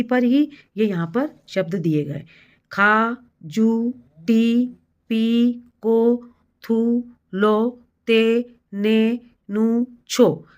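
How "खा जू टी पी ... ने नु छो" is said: very slow, drawn-out syllables, evenly spaced; slow dictation. Shown as a demonstration. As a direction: dictate each syllable slowly to young pupils. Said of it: "आपको धीरे-धीरे बोलना है".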